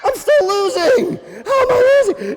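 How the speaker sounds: very high, loud, hammed-up shriek; panicked rabbit wail. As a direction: wail it in cartoon despair. Rabbit wails while losing.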